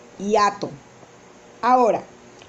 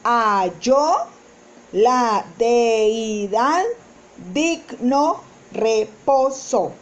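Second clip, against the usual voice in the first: slow verse reading; 2 semitones lower, much slower than usual. Shown as a demonstration. Teacher reading the diéresis example slowly for students. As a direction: read slowly, separating de-i-dad.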